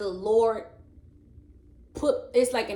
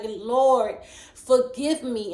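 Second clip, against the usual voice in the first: pleading cry; 3 semitones higher, 6 dB louder, much slower than usual. Retold or imitated.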